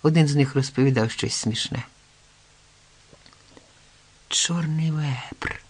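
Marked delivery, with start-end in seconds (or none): 4.3-5.6 slow, soft half-whisper; whispered, drawn-out realisation; hushed suspicion. A narrator's reading. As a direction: breathe the name slowly, as a dawning suspicion. Hero's dark suspicion dawns.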